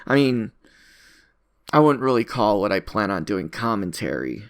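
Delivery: ordinary talk, nothing marked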